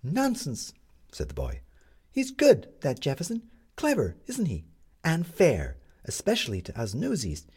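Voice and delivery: put-on chirpy paper boy; newsboy voice. A narrator's reading.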